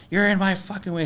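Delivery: high mock yell of the angry fisherman. Retold angry fisherman yelling at the narrator. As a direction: snap it out like the furious guy blocking the road.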